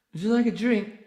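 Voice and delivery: high, loud put-on voice; acted movie character. Film character offering a drink, high loud acted voice.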